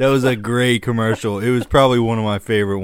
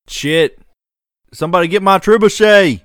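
loud, higher-pitched holler; urgent raised-voice call; 8 semitones higher, 7 dB louder than usual. Acted. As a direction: holler for someone to fetch it. Acting out someone calling for catapult.

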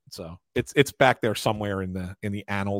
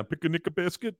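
Yogi Bear voice; 7 semitones higher, 4 dB quieter than usual. Retold or imitated. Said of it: "Yogi Bear".

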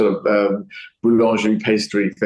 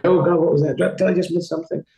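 high-pitched nervous-driver act; mock panic; 7 semitones higher, faster than usual. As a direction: act out a rattled learner driver. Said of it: "that would be a scary thing".

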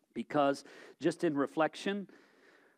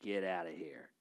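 dismissive brush-off; 13 semitones lower, 11 dB quieter than usual. Retold or imitated. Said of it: "You almost feel like saying".

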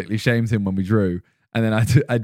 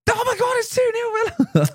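piercingly high mock squeal; imitating excited friend. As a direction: put on an excited falsetto shriek, without shouting. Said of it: "he just goes".

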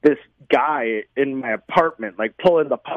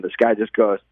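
ordinary talk, nothing marked

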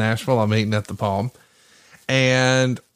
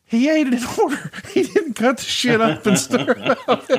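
high-pitched, cracking up mid-sentence; real laughing; 13 semitones higher than usual. Real, not acted. Host telling how his friend ate the salad, laughing hard. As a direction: squeeze the words out through helpless laughter.

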